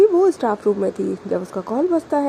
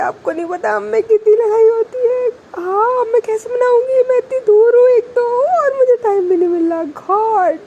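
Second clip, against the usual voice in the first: high, loud, near-crying lament; teary distress; 12 semitones higher, 6 dB louder than usual. Acted. Girl tells friend about fight, high teary lament. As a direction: wail it out, close to tears.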